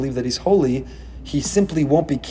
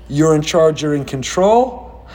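normal talking voice

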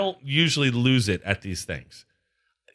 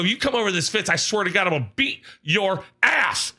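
higher-pitched mock threat; retold yelling; 5 semitones higher than usual. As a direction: threaten the heckler in re-enacted rage. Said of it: "I'm yelling so loud".

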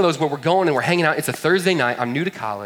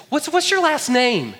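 much higher, slow and eager; eager girl; 13 semitones higher, 5 dB louder, slower than usual. Retold or imitated. Girl presses him for his surname, high and slow. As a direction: lean in high, drawing it out. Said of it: "she's like".

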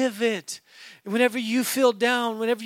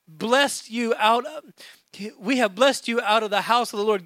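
ordinary talk

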